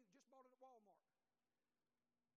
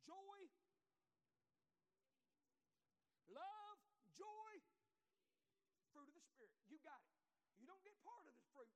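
louder, higher revival preaching, drawn out on key words; preaching at full force; 4 semitones higher, 5 dB louder than usual. Acted. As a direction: press the verses home to the church. Preaches the scripture point.

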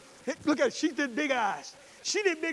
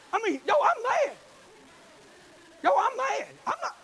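mock mad outburst; 10 semitones higher, 5 dB louder than usual.

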